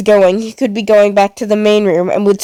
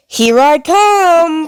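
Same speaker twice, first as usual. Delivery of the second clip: slow, high drawn-out taunt; screaming villain; 7 semitones higher, much slower than usual. Acted. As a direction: call out the hunt, stretched long. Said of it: "Pluto screamed from the main room".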